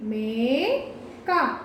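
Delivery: very long "మే", then "క" apart in "మేక"; drawn-out long vowel. Shown as a demonstration. Teacher showing where the long vowel falls, "మే" stretched. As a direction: stretch "మే" slowly before a separate "క". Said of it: "కొంచెం దీర్ఘం ఎక్కువ తీస్తేనే".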